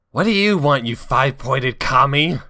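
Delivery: angry Rouge. Acted. Reading Rouge's angry line.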